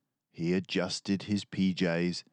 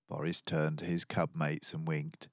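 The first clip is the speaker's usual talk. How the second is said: ordinary delivery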